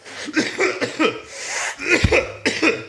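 real hacking cough; a bout of real coughing; coughing fit. Real, not acted. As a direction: cough repeatedly, in bursts. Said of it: "coughing this shit".

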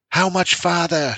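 put-on high child's shout. A narrator's reading. Son shouting to father about the seeds, high. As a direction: shout it over the rain. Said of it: "shouted Shay".

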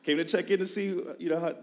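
visiting pastor telling the intern why he came, mock cheerful; pastor's reply. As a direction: mimic the pastor's cheerful answer. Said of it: "pastor's reply".

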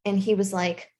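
ordinary talk, nothing marked

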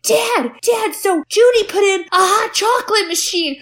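put-on high, gushing kid voice; hammed-up eager kid, breaking into laughter; mock excited child. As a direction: pester Dad with high-pitched wonder. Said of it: "electronics where they're like".

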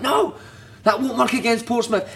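high, insistent mock telling-off; imitated manager's rebuke. Manager rejecting a run against Portsmouth, insistent imitated rebuke. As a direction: cut the player off in a high voice.

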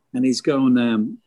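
ordinary talk

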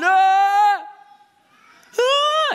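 high loud mock screams; mock panicked yelp. Pastor acting scared passengers, mock yelping.

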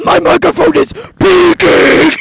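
yelling into the mic; loud shout. Real, not acted. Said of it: "fix it in post".

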